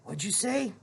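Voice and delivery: soft whispered question; whispered reply. A narrator's reading. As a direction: whisper it back. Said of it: "Piggy whispered back".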